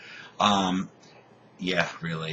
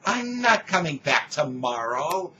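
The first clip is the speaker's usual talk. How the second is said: higher, indignant retort; mock-argument voice, pitched up; retold indignation; 6 semitones higher than usual. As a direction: replay your outraged refusal. Acted quarrel with repair man.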